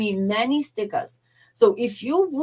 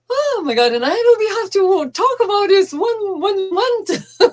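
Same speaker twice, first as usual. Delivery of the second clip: barely holding back tears; overcome with emotion; 13 semitones higher, 9 dB louder than usual. Real, not acted. Vlogger overwhelmed talking about the pilgrimage, choked-up high voice. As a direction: voice jumps much higher and louder, choking up. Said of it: "I'm actually feeling very emotional".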